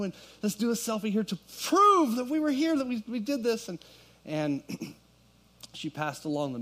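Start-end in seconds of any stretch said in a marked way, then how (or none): 0.0-3.7 excited. Retold or imitated. Retold eager selfie request.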